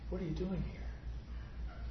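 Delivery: slow, soft, hushed question; still small voice. Retold or imitated. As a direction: linger gently on each word. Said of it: "that still small voice".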